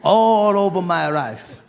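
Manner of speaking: put-on slow, half-hearted Japanese accent; slipping into Japanese accent. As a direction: drift into a Japanese accent without noticing. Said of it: "I said".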